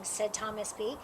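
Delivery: plain reading voice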